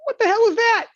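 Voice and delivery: high, loud, incredulous yell; a body shop guy gasping at a wreck; shocked mechanic. Retold or imitated. Body shop mechanic sees the wrecked car, shocked loud yell. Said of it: "they're gonna go".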